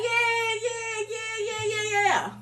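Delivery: high excited cheering yeah; high cheering yeahs urging her on; gleeful yeah. Retold or imitated. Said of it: "I'm like".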